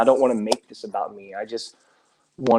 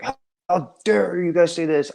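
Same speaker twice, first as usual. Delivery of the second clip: imitating an indignant huff, pitched higher; hammed-up how-dare-you, higher than his own voice; mock huffing outrage; 6 semitones higher than usual. Mocking the angry video he chose not to make, huffing. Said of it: "huffing and puffing and be like".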